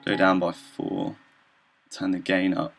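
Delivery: usual speaking voice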